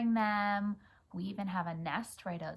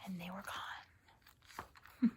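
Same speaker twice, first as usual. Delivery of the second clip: slow mysterious whisper; 9 dB quieter, slower than usual. A narrator's reading. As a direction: breathe it out like a secret. Echoing child's line.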